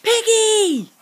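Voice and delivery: high, loud call for a friend. Acted. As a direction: shout her name across the yard. Elephant calls for his friend.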